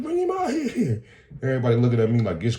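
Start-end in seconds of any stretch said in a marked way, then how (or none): 0.0-1.0 much higher squeal with a laugh in it; mock outburst, pitched way up; hammed-up high-pitched dare. Customer daring clerk to bring security out, hammed-up outburst.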